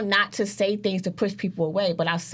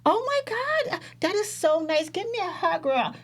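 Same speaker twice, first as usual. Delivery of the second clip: put-on much higher, gushing girlfriend voice; gushing coddle friend; 10 semitones higher than usual. The coddling friend gushing over Ra in love, high gush. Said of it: "The friend that's gonna say".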